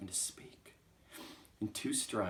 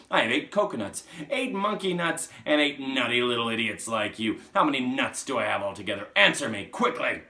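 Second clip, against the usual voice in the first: loud, raised, hectoring quiz; bullying shout; 5 semitones higher, 13 dB louder than usual. Acted. Trunchbull giving Wilfred one more chance, bullying shout. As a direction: fire the sum at him like a threat. Trunchbull drills frightened pupil.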